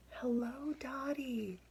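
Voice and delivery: voiceless, soft whisper. Acted. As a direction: breathe the greeting barely aloud.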